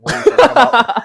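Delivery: high, loud disbelief; incredulous outburst. Retold or imitated. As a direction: scoff at her in disbelief. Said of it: "and then I went".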